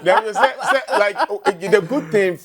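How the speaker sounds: laughing. Real, not acted. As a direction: laugh your way into the question. Host laughing while asking.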